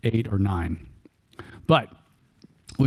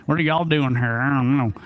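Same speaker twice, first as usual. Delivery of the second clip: put-on thick Texas accent. Speaker replaying the curious question, in a thick Texas accent. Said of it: "with the thickest Texas accent I could".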